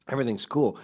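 ordinary talk, nothing marked

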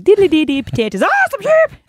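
high hammed-up Irish lilt; Irish accent. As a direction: yelp at the sheep in mock Irish. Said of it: "walking along like".

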